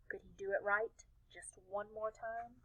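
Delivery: tense hushed whisper; nervous whispered question. A narrator's reading. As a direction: whisper it with held breath. Nervous narration before contest.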